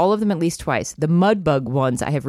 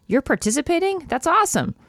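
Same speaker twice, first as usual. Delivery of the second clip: raised, bright-pitched Gertie, lightly voiced; excited old lady character voice, lightly done; Gertie's voice; 11 semitones higher than usual. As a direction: read Gertie's line up high and eager. Gertie voiced in read-aloud novel.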